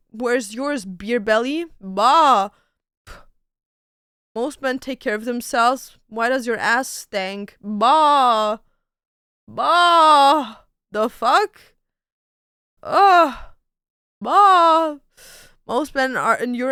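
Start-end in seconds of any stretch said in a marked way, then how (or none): 1.8-2.5 sheep bleat. Acted. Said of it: "Turning into a sheep". 7.6-15.0 loud bleating bah like a sheep; sheep bleats. Acted. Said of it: "Turning into a sheep".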